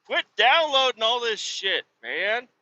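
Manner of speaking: high, whining retold complaint; exasperated scolding. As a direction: plead with the kid to stop. Retold pleading with son.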